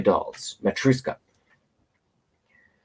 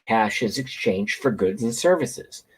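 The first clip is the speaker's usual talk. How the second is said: usual speaking voice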